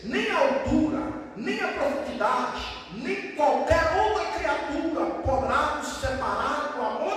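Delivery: slow, high, ringing litany; declaimed scripture. Acted. Preacher declaring nothing separates us from God's love, slow declamation. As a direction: swell on each item of the list, drawing it out.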